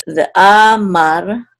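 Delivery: amar slowly, first a held long; secondary accent on a. Shown as a demonstration. Teacher building the word, amar slow with long first a. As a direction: draw out the a in amar after a short lead-in. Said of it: "there's that secondary accent again".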